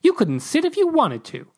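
very high, teasing jab; mock him with a squeal. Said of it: "Macy mocked".

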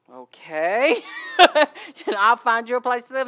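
laughing reply. Retold or imitated. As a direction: beam and laugh as you promise. Minister's reply retold, laughing.

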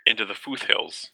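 "foothills" said slowly with a "th" sound, "footh-hills"; spelling pronunciation. Shown as a demonstration. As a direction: read "foothills" as spelled, joining t and h into th. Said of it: "that's how it's spelled".

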